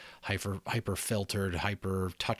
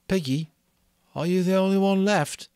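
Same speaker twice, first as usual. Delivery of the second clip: put-on higher, louder worried boy's voice; anxious boy Ralph; 11 semitones higher, 6 dB louder than usual. Ralph calling to Piggy across the platform, anxious. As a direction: call out to Piggy, uneasy.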